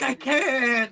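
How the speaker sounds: high, strained retold cry; panicked teen. Bitten teen answering the principal, high panicked cry. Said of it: "I'm like".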